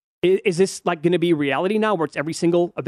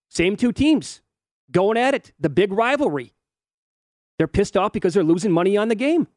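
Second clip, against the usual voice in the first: normal talking voice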